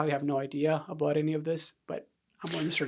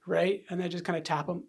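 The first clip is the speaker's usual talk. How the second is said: ordinary talk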